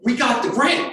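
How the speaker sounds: high-pitched excited announcement; thrilled, acted-out disbelief; freaking out. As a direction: imitate a partner bursting with news. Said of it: "And she freaks out".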